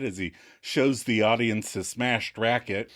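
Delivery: ordinary delivery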